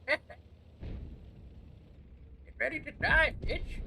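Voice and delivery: put-on high, hammy British old-man taunt; mock British old man. Gamer taunting an enemy before the kill, mock British old-man voice. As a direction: threaten in a put-on British wizard voice. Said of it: "I'm mixing an old man voice".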